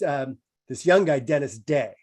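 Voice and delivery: ordinary delivery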